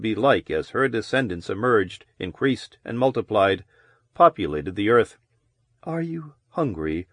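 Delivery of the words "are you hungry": soft husky question; hushed, husky woman's voice. A narrator's reading. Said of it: "Her voice was low and husky".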